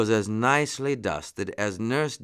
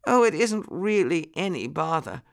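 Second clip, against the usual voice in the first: high-pitched lady muskrat voice; muskrat lady, polite; 8 semitones higher than usual. A narrator's reading. Muskrat lady answering the apologetic lion, polite.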